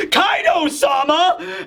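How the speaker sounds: high, drawn-out acted cry; stretched-out fanboy yell; followers' cry. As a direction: hail the boss in a mock-awed shout. Followers cry out to their boss.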